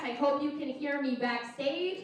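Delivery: usual speaking voice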